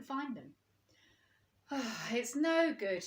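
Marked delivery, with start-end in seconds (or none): 1.7-3.0 sighing. A narrator's reading. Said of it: "he sighed".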